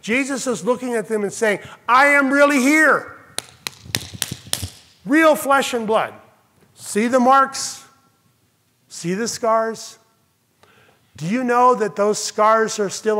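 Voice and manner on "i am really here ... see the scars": rising earnest proclamation, slowing down; earnest declaration. Acted. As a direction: plead with them to see he is real. Jesus showing his wounds.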